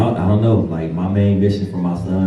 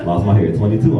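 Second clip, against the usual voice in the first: usual speaking voice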